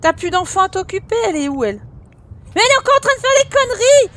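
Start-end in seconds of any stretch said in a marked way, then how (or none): 2.5-4.1 exasperated shout. Real, not acted. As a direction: blow up in fast, high exasperation. Sims child misbehaving again.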